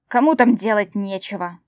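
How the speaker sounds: loud angry bark. Acted. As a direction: snap it out loudly. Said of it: "рявкнул Митиус".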